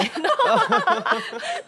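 a sudden high laugh breaking out; high burst of laughter. Real, not acted. Laughing at her own story to the hosts, a high burst of laughter. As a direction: laugh out loud, high and bright.

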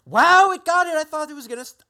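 loud, very high wow; high-pitched amazement; amazed outburst. Real, not acted. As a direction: burst out high and loud, astonished. Amazed the captions got it right.

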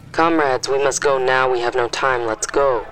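loud, urgent order to move; soldiers' shout. A narrator's reading. Soldier herding families from their homes, loud urgent shout.

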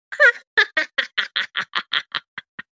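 high, evil cackle; witch cackle. Acted. Old woman passing the door cackles eerily. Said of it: "that's when you hear the cackling".

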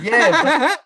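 high, laughing yeah. Retold or imitated. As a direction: laugh through the word. Laughing agreement in retold talk.

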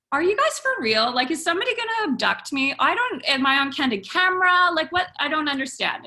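incredulous. Retold or imitated. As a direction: fire off disbelieving questions. Bewildered reply to strangers on phone.

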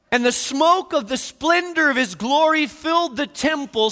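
thunderous sermon delivery; full-force preaching. Acted. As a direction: preach it big and ringing.

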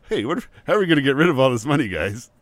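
hammed-up, hurried plea to the band; mock excited band member. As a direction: play a worked-up bandmate asking the guys. Mocks the band's sudden money problem.

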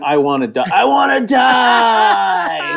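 drawn-out, high mock wail; hammed-up screaming of a death wish; imitating a drunk scream. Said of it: "He was literally screaming".

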